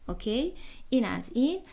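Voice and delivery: normal talking voice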